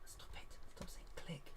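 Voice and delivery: whispered telling-off to oneself; very soft, voiceless aside; hushed self-scolding. Real, not acted. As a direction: scold yourself under your breath. Scolds self under the breath.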